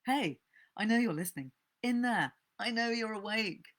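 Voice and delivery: put-on a drunk man calling out his neighbour, loud and accusing. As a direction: catch out the eavesdropper, insistent. Man confronts hidden neighbour.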